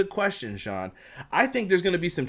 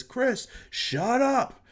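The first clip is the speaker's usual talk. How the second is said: high, very slow, stern dad voice; 10 semitones higher, much slower than usual. Retold or imitated. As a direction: lay down the law like a dad. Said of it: "Tony Schiavone coming with that dad voice".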